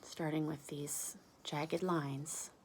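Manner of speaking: soft unvoiced narration; whisper. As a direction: whisper it like a secret.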